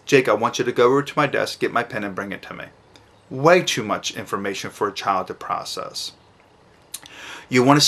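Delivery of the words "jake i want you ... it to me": fast, crammed instruction; rushed long instruction. Shown as a demonstration. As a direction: blurt the whole order quickly. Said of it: "her sentence was way too long".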